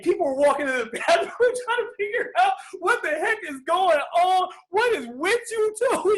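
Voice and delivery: high, incredulous voice with a laugh in it; baffled onlookers. Retold or imitated. Strangers walking in on crying father and son, incredulous. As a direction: voice startled passersby, amused and loud.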